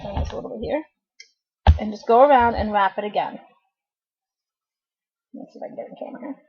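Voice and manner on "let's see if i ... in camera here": whispered aside. Real, not acted. Quick muttered aside to herself.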